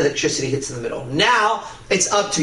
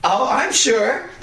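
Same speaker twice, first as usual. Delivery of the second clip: high, slow mock-girly scoff; mock indignant girl, high-pitched; imitating the other girl; 9 semitones higher, much slower than usual. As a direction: play the offended girl, high and drawn out. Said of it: "now the other one".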